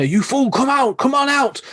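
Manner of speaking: high, strained call down the phone line. Retold or imitated. Carter calls down the phone to his missing friend, high and strained. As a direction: call your friend back up from the tunnels. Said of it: "And Carter's like".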